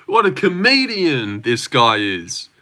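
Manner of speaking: high, gushing fake admiration; hammed-up fawning praise; mock amazement. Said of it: "it's not like".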